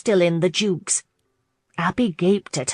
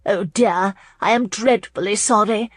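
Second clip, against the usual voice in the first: mildly horrified, raised-voice apology; 4 semitones higher, 4 dB louder than usual. A narrator's reading. Hostess sees the Countess choke, mildly horrified. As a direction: apologise with a touch of horror. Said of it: "said in horror".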